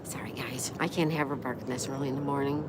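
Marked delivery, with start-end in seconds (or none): 0.0-0.7 soft whispered sorry to viewers; whispered apology. Real, not acted. Whispering sorry to viewers after hushing the dog. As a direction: whisper it so the dog stays calm.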